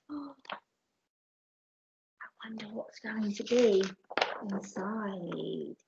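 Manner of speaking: hushed whisper with long pauses; whispered suspense. A narrator's reading. Storyteller to listeners before the chest opens, whispering. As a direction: whisper it slowly, building suspense.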